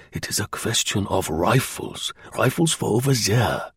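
put-on German-accented disclosure; confiding German agent. German agent revealing rifles to Slingsby, confiding and accented.

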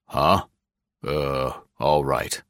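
lightly voiced gruff boss; gruff official Gregg. A narrator's reading. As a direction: read him as a grumbling bureau chief. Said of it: "thrown off his stride for a moment".

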